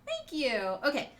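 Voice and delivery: usual speaking voice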